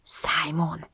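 very soft, whispered name; stunned whisper. A narrator's reading. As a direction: breathe the name almost without voice. Stunned at hearing her brother's name. (döbbenten suttogva)

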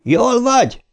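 high-pitched, drawn-out holler; yelled question. A narrator's reading. Ben yells to Eddie after the fall, high holler. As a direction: call out urgently to check on a friend. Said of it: "üvöltötte Ben".

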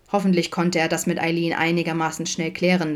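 ordinary delivery, nothing marked

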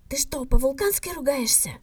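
ordinary delivery

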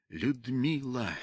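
soft, very slow, earnest address; heartfelt. A narrator's reading. He appeals to Людмила, heartfelt and soft. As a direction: plead with quiet sincerity. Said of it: "сказал он проникновенно".

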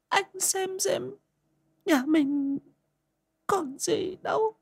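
put-on high, frail old woman's voice, begging; an aged mother pleading with her wayward son. A narrator's reading. Mother begging her gambling son to change, high frail pleading.

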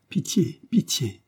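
high, sighing plea for mercy; sighed plea. A narrator's reading. Dying woman begs Cornélie for mercy, sighing. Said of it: "soupira-t-elle".

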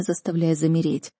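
plain reading voice

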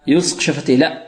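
normal talking voice

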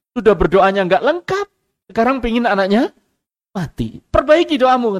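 loud, high rebuke of the father; angry scolding. Retold or imitated. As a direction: scold him as a wrongdoer who wronged his son. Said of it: "Apa kata orang sang askari?". (memarahi dengan suara keras dan tinggi)

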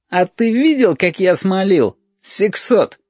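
put-on high, stung child's retort; outraged kid answering an accusation; indignant boy. A narrator's reading. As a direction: throw it back in a boy's high voice.

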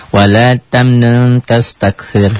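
Wala tamnun tastakthir slowly, with a nasal hidden nun in tamnun; nun ikhfa. Shown as a demonstration. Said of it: "Nunnya ikhfa".

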